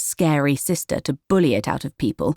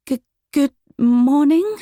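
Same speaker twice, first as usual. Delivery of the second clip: higher, slow, stammering reply; nervous stammer; 10 semitones higher, 4 dB louder than usual. A narrator's reading. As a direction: barely get the words out. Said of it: "managed Willow".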